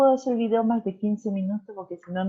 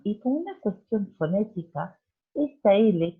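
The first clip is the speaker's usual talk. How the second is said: normal talking voice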